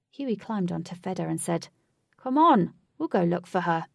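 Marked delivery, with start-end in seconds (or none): 2.2-3.9 coaxing. Acted. Huey coaxing.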